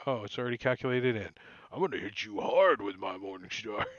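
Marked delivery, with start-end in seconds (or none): 1.7-3.9 low bugbear voice; lower bugbear threat. Acted.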